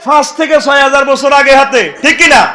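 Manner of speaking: loud, high and fast sermon shout; fiery preaching. Acted. Preacher shouts at the crowd about Pharaoh's preserved body. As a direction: drive the point home to the crowd.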